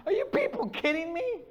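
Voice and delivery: ordinary talk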